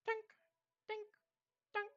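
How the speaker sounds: high little tink sound effects; ping out each pickaxe strike. Acted. GM mimicking distant pickaxes in the mine.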